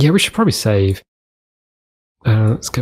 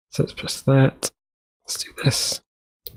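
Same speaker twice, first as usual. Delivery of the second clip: whisper; 2 semitones higher, 7 dB quieter than usual. Real, not acted. Whispered aside.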